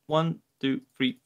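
ordinary talk, nothing marked

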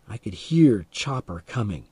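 usual speaking voice

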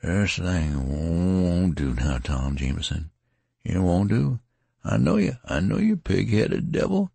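a dazed wounded man repeating himself; mildly slurred dazed plea; slurred rambling. A narrator's reading. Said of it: "the words were slurred".

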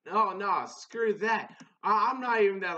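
mock angry Joker. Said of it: "was cussing him out and everything".

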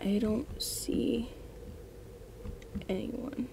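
slow, breathy whisper while hiding; peeking out from hiding, slow frightened whisper; hushed whisper. Real, not acted.

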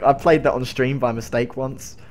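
ordinary talk, nothing marked

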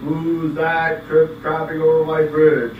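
slow, loud threatening voices; loud troll challenge. Acted. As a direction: boom it out slowly. Troll and big goat.

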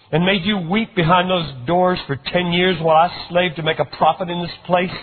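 bitter outburst. A narrator's reading. Older son's bitter outburst.

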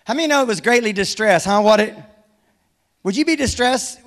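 ordinary talk, nothing marked

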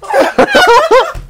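slow, breathless words through laughter; wheezing with laughter; laughing hard. Real, not acted. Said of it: "I can't breathe".

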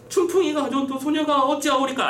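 high, put-on pleading voice; pleading accused. Accused denies taking the money to the official, high pleading.

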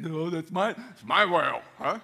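put-on loud, childish "it's my whale" whine; mock whiny protest. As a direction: whine it like a kid grabbing a toy. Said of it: "Yeah, like that".

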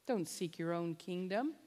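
ordinary talk, nothing marked

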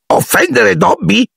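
very high, slow whining elf; whimpering. A narrator's reading. House-elf snivelling at Harry's apology, very high. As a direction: snivel it in disbelief. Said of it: "piagnucolò l'elfo".

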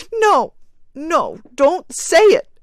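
high, loud, frantic cry; cried wildly. Acted. Grieving mother stopping her husband, wild and loud. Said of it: "cried the woman wildly".

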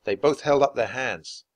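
ordinary delivery

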